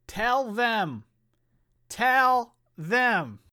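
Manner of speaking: high, drawn-out call; hammed-up title call, pitched high; theatrical announcement. Co-host calling on Dan to tell, theatrical announcement.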